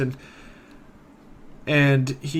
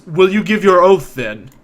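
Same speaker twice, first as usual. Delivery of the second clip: raised, louder challenge; scowling demand; 4 semitones higher, 6 dB louder than usual. Acted. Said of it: "He scowls and he says".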